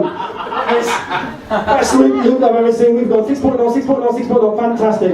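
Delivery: high, gleeful scoring; excited. Retold or imitated. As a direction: cheer the score like a judge. Mimicking Hamish's excited judging.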